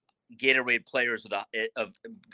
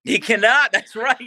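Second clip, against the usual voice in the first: loud, high burst breaking into laughter; amused agreement; 12 semitones higher, 9 dB louder than usual. Real, not acted. Guest agrees with host's joke about the young prospect, loud and laughing. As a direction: agree loudly, pitch jumping up, about to laugh.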